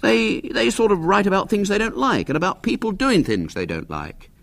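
put-on high boyish explaining voice; lightly pompous boy's wisdom; air of deep wisdom. A narrator's reading. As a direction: explain like a young expert, pitched high. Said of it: "with an air of deep wisdom".

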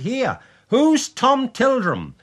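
loud, high, drawn-out call. A narrator's reading. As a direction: call out urgently as you rush in. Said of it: "calling out".

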